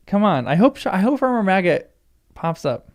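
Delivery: ordinary delivery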